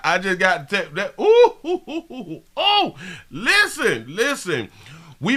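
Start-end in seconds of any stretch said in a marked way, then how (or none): 1.2-2.4 high laughing ooh ooh; excited high-pitched oohs; high ooh squeal. Real, not acted.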